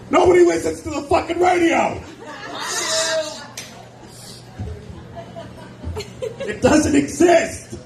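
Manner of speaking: mock-outraged yell about radio; exasperated rant. Real, not acted. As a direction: yell it like the radio betrayed you. Ranting about radio being dead.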